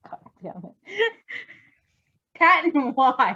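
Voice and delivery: high, slow, laughing through the words; losing it mid-read; cracking up. Real, not acted. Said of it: "I was trying so hard".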